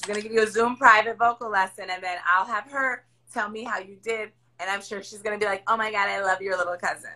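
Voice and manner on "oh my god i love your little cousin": usual speaking voice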